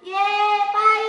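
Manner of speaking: loud reply. Acted. Mother replying.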